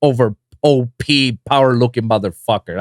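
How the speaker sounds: ordinary delivery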